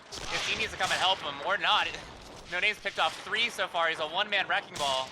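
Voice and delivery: loud, high hyped play-by-play; excited shout. Real, not acted. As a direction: call it like the crowd's on its feet. Caster hyping a multi-kill.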